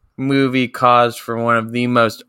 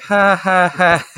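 forced laugh; 7 semitones higher, slower than usual. Retold or imitated. Audience's forced laughter imitated.

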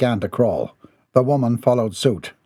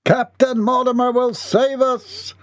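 very high, stretched-out cry; desperate acclaim; 13 semitones higher than usual. A narrator's reading. Frightened men hail their captain, high slow cry. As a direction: proclaim the saviour in a high voice.

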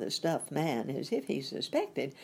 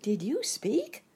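put-on high, drawn-out question from a girl; little girl marvelling, voice lifted; wondering; 9 semitones higher, 3 dB louder, slower than usual. A narrator's reading. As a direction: ask it slowly, pitched up in wonder. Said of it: "asked the girl in wonder".

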